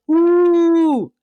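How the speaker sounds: loud, high put-on wail; hammed-up attention-seeking cry; mock crying. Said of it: "she starts crying".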